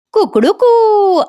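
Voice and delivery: slow, high mock cock-a-doodle-doo; rooster crow. [name] gloating, imitates a high rooster crow.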